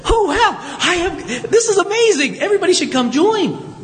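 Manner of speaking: high-pitched, gushing mock delight; playing an overjoyed prophet, hammed up; mock excitement. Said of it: "It's not like Isaiah says".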